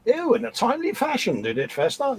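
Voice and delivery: hammed-up toff, very high swooping pitch; mock toff. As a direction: play a silly upper-crust gent, voice leaping high. Mock-formal banter, ends in "what, what.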